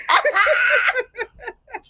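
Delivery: high, loud disbelieving cackle; loud laugh. Real, not acted. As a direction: burst out in a shrieking laugh. Laughing at the betrayal.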